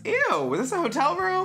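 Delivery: high-pitched, disgusted squeal; grossed-out outrage. Real, not acted. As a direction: squeal the question in revulsion. Grossed-out reaction to messy story.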